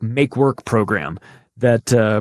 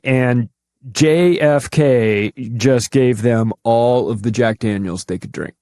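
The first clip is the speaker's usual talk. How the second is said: ordinary talk, nothing marked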